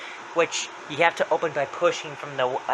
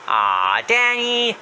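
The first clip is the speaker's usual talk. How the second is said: Happy's voice; 11 semitones higher, 5 dB louder, slower than usual. Retold or imitated. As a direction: swoop up and draw it out mockingly. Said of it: "Happy says".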